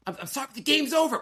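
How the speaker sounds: high, hurried excuse; retold defensive apology. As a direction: re-enact a flustered dad defending himself. Retold argument with child.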